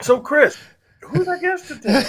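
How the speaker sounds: hammed-up, high-pitched mock host question; scripted co-host cue. Co-host delivers his missed line to Chris, hammed-up. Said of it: "can I take a sip of my beverage and go".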